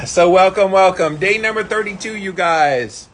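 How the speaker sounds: loud, high, buzzing from the cold; excited. Real, not acted. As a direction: greet them, pumped from the icy shock. Still in ice water.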